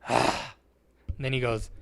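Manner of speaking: ordinary delivery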